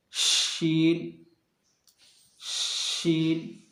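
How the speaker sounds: शीन stretched long, the sh hissed out slowly; slow drawn-out sheen. Shown as a demonstration. Teacher demonstrating the letter, very slow. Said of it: "इसको ऊपर सटाएं और फिर बोलें".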